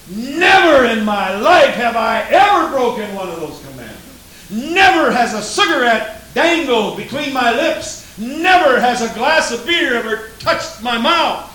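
loud, high, proud shouting of each never; hammed-up pious bragging; self-righteous boast. Preacher mimics self-righteous boast.

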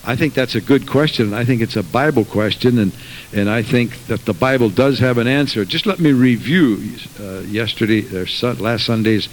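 loud pulpit preaching; full-voiced sermon delivery. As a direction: drive it home to the pews. Preaching to congregation.

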